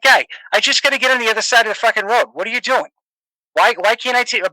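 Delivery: hammed-up Boston guy griping at the road; Boston accent. Quoting himself stuck at a stop sign in a hammed-up Boston accent. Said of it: "I'll tell you a story in, in a Boston accent".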